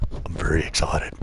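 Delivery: low mock-excited voice; deadpan fake excitement, low; silly clown. Deadpan joke after "so exciting.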